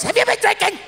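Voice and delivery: very high falsetto, suspicious; wife's voice. Retold or imitated. Wife catching her drunk husband, high suspicious falsetto. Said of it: "Then I do her voice".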